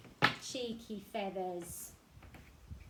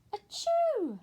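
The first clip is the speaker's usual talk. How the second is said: high, loud fake sneeze; 12 dB louder than usual. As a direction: sneeze it big. Pinecone sneezes.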